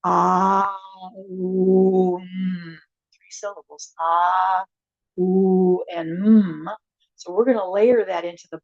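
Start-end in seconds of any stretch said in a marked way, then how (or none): 0.1-2.8 "ah", "oo", "mm" drawn out as separate syllables; om in three parts. Shown as a demonstration. Teacher showing the class how om splits into "ah", "oo", "mm". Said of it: "it is pronounced more like". 4.0-6.7 "ah", "oo", "mm" drawn out as separate syllables; om in three parts. Shown as a demonstration. Teacher showing the class how om splits into "ah", "oo", "mm". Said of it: "it is pronounced more like".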